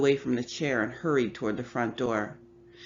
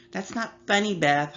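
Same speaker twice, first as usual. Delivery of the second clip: higher, teasing echo of mom's words; mocking little sister; 7 semitones higher, 4 dB louder than usual. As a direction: mimic mom in a high, cheeky way. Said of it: "Holly laughed".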